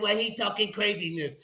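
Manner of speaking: ordinary delivery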